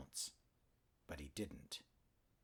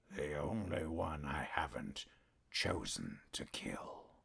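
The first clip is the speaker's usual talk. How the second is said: whispered, dropping much lower into a slow growl; 5 semitones lower, 4 dB quieter than usual. A narrator's reading. Arcove answers Charter's accusation, dropping into a low growl. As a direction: let it sink into a growl. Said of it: "Arcove's voice dropped to a growl".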